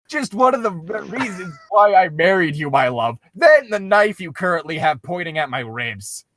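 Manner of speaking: a nervous husband sweet-talking his wife; Link voice. Acted. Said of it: "Link returned".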